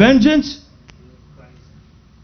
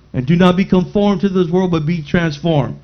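lower, measured Bible reading; scripture reading; 4 semitones lower than usual. Acted. Preacher reads scripture aloud.

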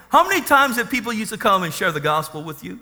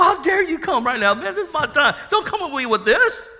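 angry; 7 semitones higher than usual. Retold or imitated. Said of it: "I was really angry".

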